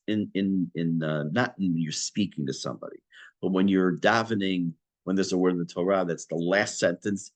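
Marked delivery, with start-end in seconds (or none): none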